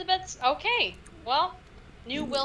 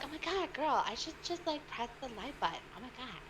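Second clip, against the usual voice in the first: quiet; 8 dB quieter than usual. Retold or imitated. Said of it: "I was like".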